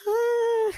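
a high sharp "ah" demonstrated; high release cry. Shown as a demonstration. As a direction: show the tension-release yelp. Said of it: "It's like a".

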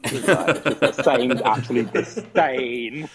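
laughter bursting through the words; laughing. Real, not acted. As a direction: crack up as you describe it. Cracking up at player's expression.

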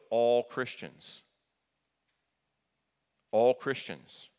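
slow, weighted "all Christians"; "all Christians" drawn out and stressed. Shown as a demonstration. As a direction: lean on "all" and slow right down. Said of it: "Let me say it again".

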